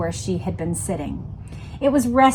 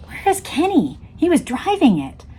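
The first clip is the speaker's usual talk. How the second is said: frantic; 8 semitones higher than usual. Retold or imitated. Frantic inner questions.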